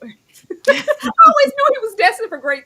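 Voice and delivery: high, loud proud cook. Retold or imitated. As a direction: beam with pride through a laugh. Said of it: "She's like".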